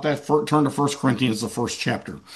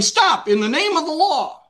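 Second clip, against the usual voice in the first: mock yell; 11 semitones higher than usual. Cop chasing the bad guy down the street, mock yell. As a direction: imitate a cop calling out in a high voice. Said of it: "he's yelling".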